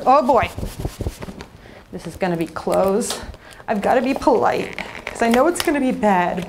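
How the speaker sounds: ordinary talk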